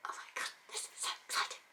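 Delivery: fast breathless whisper; excited whisper. Real, not acted.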